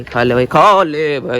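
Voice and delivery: slow gentle coaxing of an animal. Real, not acted. Coaxing the bull to eat. (धीरे से प्यार से पुचकारते हुए)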